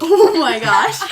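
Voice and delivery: loud shocked laugh; real burst of laughter. Real, not acted. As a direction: laugh out the words in disbelief. Shocked at a dark joke.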